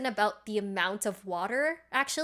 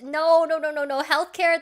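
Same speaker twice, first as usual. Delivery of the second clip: emphatic protest; 5 semitones higher, 6 dB louder than usual. Real, not acted. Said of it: "joke about patients as vegetables".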